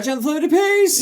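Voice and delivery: high, loud cartoonish cry; shrill-pitched mock character shout; character catchphrase. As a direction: shout the sign-off in a high put-on voice. Quoting a character's sign-off line.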